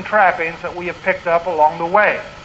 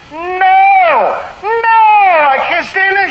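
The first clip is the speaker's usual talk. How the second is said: imitating her scream, high and loud; retold blood-curdling scream; 12 semitones higher, 5 dB louder, much slower than usual. Pastor retelling a counselee's scream, imitated loud high shriek. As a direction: recreate the lady's panicked shriek. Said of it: "the most blood-curdling scream came out of her mouth".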